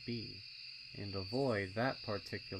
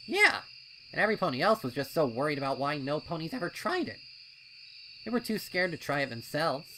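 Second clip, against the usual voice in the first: loud, fast, doubting colt voice; a wary colt talking his friend out of it; skeptical colt; 7 semitones higher, 10 dB louder, faster than usual. A narrator's reading. Snips questioning Pipsqueak's wish to enter, loud and fast.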